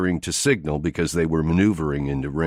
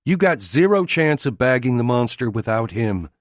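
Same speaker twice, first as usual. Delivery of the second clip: female character voice; 7 semitones higher than usual. A narrator's reading. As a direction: challenge him in her voice. Jamie confronts Haslam, voiced female.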